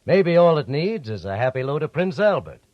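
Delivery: ordinary delivery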